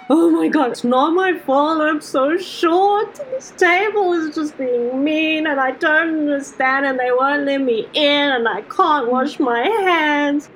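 put-on high, wailing drunk sob-talk; drunk crying. Short goblin wailing drunkenly at a mean table. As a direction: whine it like a weepy drunk. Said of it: "drunk crying".

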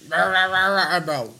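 mock a man nobody can understand; garbled Chicken imitation. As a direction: gibberish stand-in for mumbled speech. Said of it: "I can't barely understand half of his".

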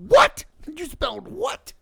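very high shriek, rising at the end; hammed-up outraged disbelief; imitated shocked mom. Retells wife's shocked reaction.

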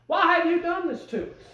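high, questioning mother's voice; worryful mother. Retold or imitated. Preacher voicing Mary questioning her twelve-year-old son, mildly worried. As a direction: ask it like a fretting mother. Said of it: "A worryful mother".

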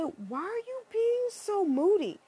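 high, slow mock whine; slow sarcastic quote; mock whiny friend. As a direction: drag it out mockingly. Quoting friend.

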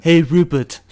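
high, loud cartoon voice; Stewie Griffin. Friend greets the character's companion, in cartoon impression. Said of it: "trying to perfect my Stewie Griffin voice".

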